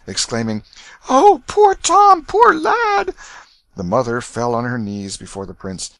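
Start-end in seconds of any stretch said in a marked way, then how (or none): 1.1-3.1 very high, loud, drawn-out lament; wailing dismay. A narrator's reading. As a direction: cry out in woe and pity. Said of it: "woe and dismay in their faces".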